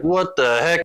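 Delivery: high, puzzled exclamation; baffled viewer reaction. Retold or imitated. Viewer reacting to the tunnel scene, high mock bafflement. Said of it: "You're like".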